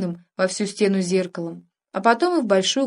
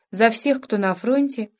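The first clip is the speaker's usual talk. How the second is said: usual speaking voice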